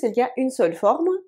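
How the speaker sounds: ordinary talk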